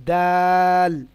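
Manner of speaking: দাল drawn out very slowly and loud; a long, firm দাল; hard thin dal. Shown as a demonstration. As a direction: stretch দাল, firm and light. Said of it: "শক্ত ও পাতলা আওয়াজে".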